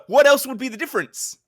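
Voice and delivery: loud, high-pitched baffled question; exasperated. Real, not acted.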